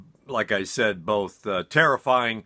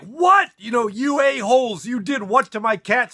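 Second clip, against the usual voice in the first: much higher and louder, indignant shout; outraged yell; 13 semitones higher, 5 dB louder than usual. Retold or imitated. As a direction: yell at them like they've killed your cat. Narrator yells at roommates.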